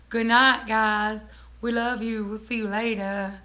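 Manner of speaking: ordinary talk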